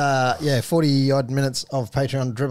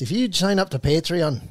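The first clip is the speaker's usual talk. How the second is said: higher, louder stage Irish plug; mock Irish; 6 semitones higher, 4 dB louder than usual. Host reading the Patreon plug in mock Irish. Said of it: "I've gotta stop it".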